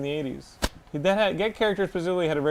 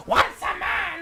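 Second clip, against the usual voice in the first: cartoon villain voice; 10 semitones higher, 3 dB quieter, slower than usual. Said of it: "Is like".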